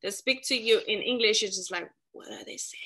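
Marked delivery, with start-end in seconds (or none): 2.1-2.8 whispered puzzled question; hushed mock bewilderment; baffled aside. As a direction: whisper it as if to yourself, confused. Acts out confusion at accents.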